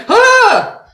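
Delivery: high loud startled yelp; high startled shout waking at a loud boom. Retold or imitated. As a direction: jolt awake with a sudden cry. Scott jolted awake in theater.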